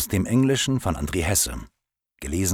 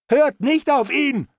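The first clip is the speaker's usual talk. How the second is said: high, loud desperate cry; called out; 5 dB louder than usual. Acted. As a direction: shout the warning to the others, pitched high. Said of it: "rief das Rothaarige". (hoher, lauter, verzweifelter Ruf)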